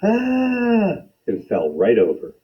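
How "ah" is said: sudden high scream of fright; high startled yell. Acted. Lady scared at the door, high startled scream. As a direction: yelp in terror. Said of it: "The lady yelled".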